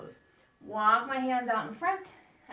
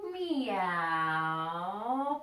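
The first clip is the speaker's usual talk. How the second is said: very long, swooping meow; drawn-out cat call. Pretend. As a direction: meow like a cat, dipping and rising. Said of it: "A cat says".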